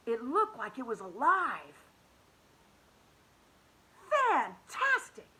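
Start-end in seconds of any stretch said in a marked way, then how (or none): 4.1-5.3 slow, high, loud marvel; amazed exclamation. Acted. Hoppy marvels at the painting, slow and high. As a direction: gasp it out in wonder. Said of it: "Hoppy exclaimed".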